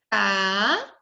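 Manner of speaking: CA drawn out on its own; isolated syllable. Shown as a demonstration. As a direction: sound out Ca as one held syllable. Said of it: "Vamos separar?".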